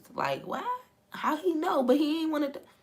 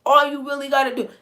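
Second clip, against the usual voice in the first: higher and louder, fast, preaching the point; loud, eager retelling of the verse; excited paraphrase; 8 semitones higher, 12 dB louder, faster than usual. Retold or imitated. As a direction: hype up the verse. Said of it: "He's literally saying".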